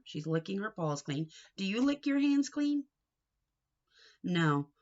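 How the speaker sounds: usual speaking voice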